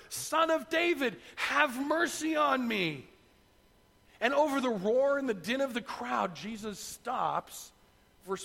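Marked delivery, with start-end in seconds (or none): 0.1-3.1 high, slow call, not at full volume; acted insistent shout. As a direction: call out again, stretching every word. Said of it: "He only shouts louder".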